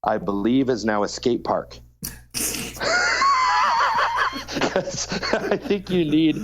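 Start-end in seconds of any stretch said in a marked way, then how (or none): 2.3-4.7 a big high whooping laugh. Real, not acted. Laughing at skate park idea. 4.7-6.4 laughing while talking. Real, not acted. Laughing through the words.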